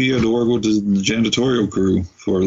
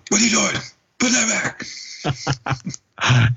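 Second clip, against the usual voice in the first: much higher-pitched angry outburst; mock attack; 13 semitones higher than usual. Teacher attacking a student stealing food, high-pitched mock outburst. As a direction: imitate someone pouncing on him, pitch shooting up. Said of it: "he attacks him".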